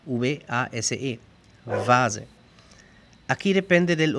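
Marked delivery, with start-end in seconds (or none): 1.6-2.3 "vase" with a buzzing v, "vaaze"; German "vase", voiced v and z; V inglesa. Shown as a demonstration. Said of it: "como la V en inglés". ("vase" con v vibrante, "vaaze")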